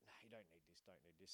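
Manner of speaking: a low murmured self-warning; quiet inner voice. Retold or imitated. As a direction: talk yourself out of it quietly. Inner voice resisting the drink.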